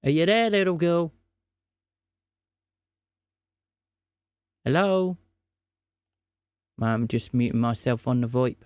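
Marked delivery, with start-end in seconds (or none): 0.0-5.2 stretched-out, searching hello; calling out. Acted. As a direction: call out to see if anyone is there. Calling into a silent phone line.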